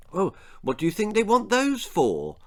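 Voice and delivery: put-on high, eager child's question; lightly voiced small girl asking; a girl's wide-eyed question; curious little girl. A narrator's reading. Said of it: "asked Parker".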